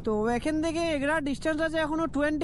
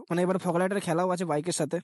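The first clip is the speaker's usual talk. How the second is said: ordinary delivery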